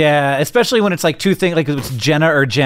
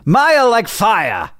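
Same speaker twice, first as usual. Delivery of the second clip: slow, higher-pitched mock British; fake British accent; 6 semitones higher, much slower than usual. Shown as a demonstration. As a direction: draw out "Maya like fire" in put-on British, no r. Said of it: "That's my British accent".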